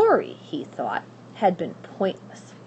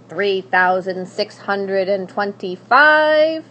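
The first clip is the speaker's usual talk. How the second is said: loud, slow counting; witch counting, louder; 8 dB louder, slower than usual. Acted. Witch ignoring the princess, counting louder. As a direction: drown her out with numbers.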